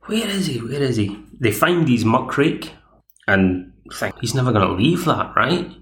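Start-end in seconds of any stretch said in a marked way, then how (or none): 0.0-0.6 higher mock call, searching. Friends hunting for their missing companion, a light high call.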